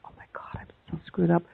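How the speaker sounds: whispered self-reproach; inner whisper. Retold or imitated. Remembering her own embarrassed thoughts, whispered. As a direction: breathe it out, barely voiced. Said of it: "And I was like".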